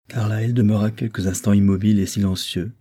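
ordinary delivery

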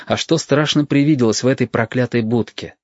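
plain reading voice

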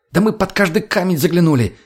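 high-pitched protesting excuse; making excuses. A narrator's reading. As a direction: defend yourself to an angry boss. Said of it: "Начал было оправдываться тот".

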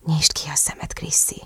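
very soft, breath-only request; coaxing whisper. A narrator's reading. Lover asking Chrissy to look, coaxing whisper. Lover coaxes partner in narrated scene.

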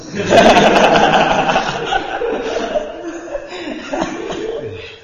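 long airy burst of laughter; laughing. Real, not acted. Ustadz laughing at the student's answer, airy laugh. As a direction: crack up breathlessly.

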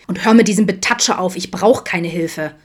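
loud angry outburst, slowing at the end; angry shout. Acted. Adam yells at Vincent to stop touching him. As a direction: yell him off, furious.

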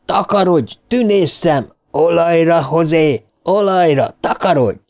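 high, urgent narrated shouting; yelling it away. A narrator's reading. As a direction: chase the monster off in short high cries. Eddie drives the monster away. (elkergető kiabálás)

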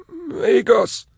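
a wrecked prisoner forcing out a forgotten word; broken stammer. Acted. Caged prisoner haltingly naming himself to strangers, high stammer. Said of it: "working very hard to articulate".

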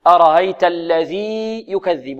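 slow recitation, الذي held long before يكذب; separated ya. Shown as a demonstration. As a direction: stretch the ī of الذي, then a clean y. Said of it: "وهذه مثلها". (الذي يكذب بإظهار الياء)

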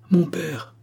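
high-pitched acted exclamation, not shouted; emotional cry. A narrator's reading. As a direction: call out to a father, reaching for him. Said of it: "s'écria la marquise en tendant les bras vers lui".

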